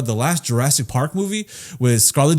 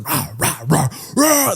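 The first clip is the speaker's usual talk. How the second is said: mock dinosaur roars, rising in pitch; T-Rex roar; slower than usual. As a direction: imitate a roaring T-Rex in short bursts.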